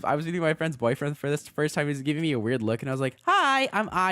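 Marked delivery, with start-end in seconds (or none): 3.2-4.1 very high, loud, bright hello; high-pitched, squeaky greeting; own high-voiced greeting. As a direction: chirp the introduction way up high. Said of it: "what was he think I was gonna sound".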